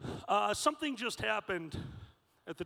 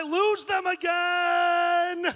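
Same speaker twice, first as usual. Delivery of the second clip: slow, high calling out; shouting; 8 semitones higher, 9 dB louder, much slower than usual. Real, not acted. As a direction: call into the crowd. Searching the crowd aloud.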